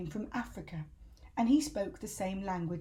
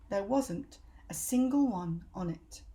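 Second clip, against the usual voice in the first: plain reading voice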